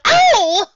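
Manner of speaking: high loud yelp; sharp yelp of pain. Acted. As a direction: cry out at the cut. Lyra cuts finger.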